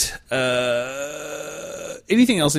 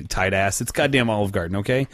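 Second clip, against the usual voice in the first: ordinary delivery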